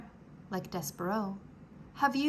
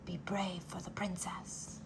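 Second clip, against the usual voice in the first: soft voiceless whisper; 4 semitones lower, 10 dB quieter than usual. Acted. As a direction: press it into his ear. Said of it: "whispered the threadmaster".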